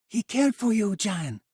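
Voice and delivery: high, loud anguished plea; a woman pleading with the boy; pleading cry. Acted. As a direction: beg him to stop. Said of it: "Valeria cried".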